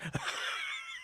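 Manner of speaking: high breathless squeal of a laugh. Retold or imitated. Celebrating a win with a squealing laugh. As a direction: let out a giddy airy shriek of laughter.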